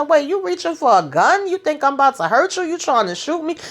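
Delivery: alarmed disbelief. Retold or imitated. Tariq seeing her reach for a gun, mock alarm. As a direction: react like she just went for her gun.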